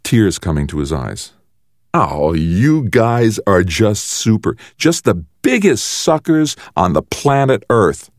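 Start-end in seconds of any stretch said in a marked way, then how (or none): 1.9-8.1 slow, drawn-out gloating, pitched up; gleeful mockery. A narrator's reading. Brother jeers at the siblings he fooled, gleeful mockery. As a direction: stretch the jeer out high. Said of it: "started to laugh. Loud, but with real mirth".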